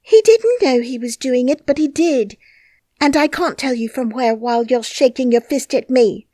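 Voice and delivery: put-on high, loud, defiant girl; stubborn protest. A narrator's reading. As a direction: stand her ground. Mary keeps protesting.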